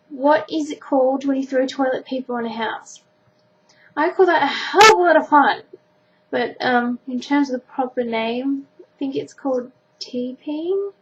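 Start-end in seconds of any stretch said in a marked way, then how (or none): 4.0-5.6 playful. Acted. Joking answer, loud and far.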